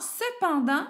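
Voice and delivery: "cependant" lifted high; stress on the substitute. Shown as a demonstration. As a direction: point out "cependant" with a high rising lift. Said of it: "je le remplace".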